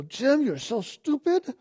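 high, slow put-down of himself; mock himself like a scornful critic; a sneering inner critic calling himself stupid; self-scolding inner voice.

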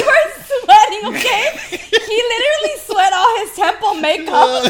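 can barely get it out for laughing; laughing hard. Real, not acted. Telling a friend the story, breaking into laughter.

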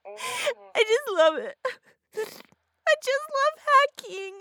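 high, slow, tearful; teary gushing. Acted. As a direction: gush about it while welling up. Worked up, near tears.

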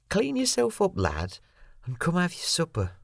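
put-on cheerful woman calling the boy, high pitch; cheery Moira. A narrator's reading. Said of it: "Moira sounded cheery".

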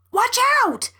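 high, urgent cry of alarm; smoky-voiced warning. A narrator's reading. Raccoon warns the woman of a skidding car, urgent high cry. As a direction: call out a sudden warning, pitched high. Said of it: "came the smoky voice".

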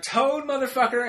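high mock yell at normal volume; retold scream. Ranting to Sarah about towing, mock screaming. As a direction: pitch it right up as imitated fury. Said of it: "I'm screaming really".

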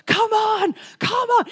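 high eager call, come on; rally the room like a caller; urgent high-pitched summons. Retold or imitated. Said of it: "it was like he was calling us, going".

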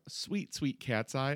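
normal talking voice

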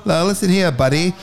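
put-on high, old-time Mid-Atlantic newsreel voice; Mid-Atlantic accent. Shown as a demonstration. Bandmate shows off his Mid-Atlantic accent to the podcast. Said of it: "Okay, Mid-Atlantic".

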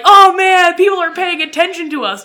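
loud, high thrilled exclamation; hammed-up delight at being noticed; mock excitement. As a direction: gush like an attention-starved kid. Acting Canada thrilled at foreign attention.

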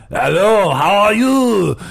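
loud, exaggerated French accent; drawn-out, hammed-up French greeting. Imitating schoolmate back from camp, super French and loud. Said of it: "super French. I swear to God".

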